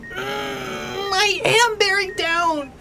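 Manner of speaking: slow, high strained push through the pain; straining in labor. Acted. Mother in labor straining as the doctor says bear down. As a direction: groan it out while pushing hard.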